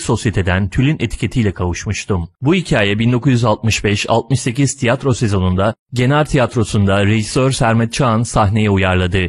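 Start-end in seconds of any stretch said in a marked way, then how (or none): none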